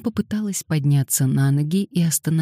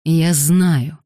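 loud, angry growl; growled retort; 5 dB louder than usual. A narrator's reading. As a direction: growl it back at him. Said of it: "прорычал в ответ Маркус". (рыча, громко)

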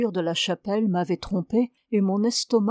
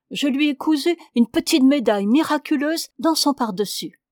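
lightly voiced eager penitent, a bit louder; lightly voiced wife confessing to her priest; pious young wife; 5 semitones higher, 4 dB louder than usual. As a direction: plead her case sweetly to the priest.

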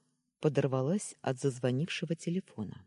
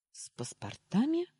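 astonished question, higher, rising; surprised; 11 semitones higher than usual. Acted. Girl surprised about the passports, rising astonished question. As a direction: lift С паспортами in disbelief. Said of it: "удивилась я".

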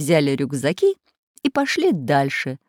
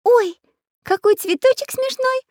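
put-on much higher, delighted girl voice; little girl voice; 3 dB louder than usual. A narrator's reading. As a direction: squeal like an excited little girl. Little girl Nastenka speaks.

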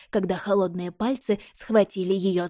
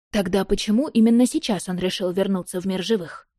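usual speaking voice

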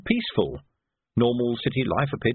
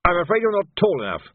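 higher, quick polite brush-off; hasty major; 7 semitones higher, 3 dB louder than usual. Acted. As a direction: get rid of him fast.

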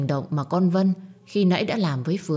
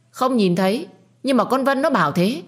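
high, louder defensive snap; stubborn defense; 8 semitones higher, 4 dB louder than usual. A narrator's reading. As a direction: brush off the question stubbornly, higher and louder. Mother defends herself stubbornly. (bà mẹ cãi cố, giọng cao và to)

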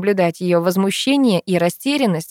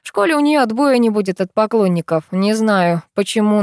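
ordinary delivery, nothing marked

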